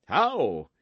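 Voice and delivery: shocked knight crying out; sharp cry. Acted. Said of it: "he cried in a changed voice".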